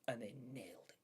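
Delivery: whispered emphasis, breath only; hushed. Real, not acted. Streamer whispering praise to viewers, awed.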